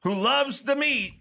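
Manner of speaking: incredulous slow rant; indignant rant. Real, not acted. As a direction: demand an answer in disbelief. Ranting about broccoli on pizza.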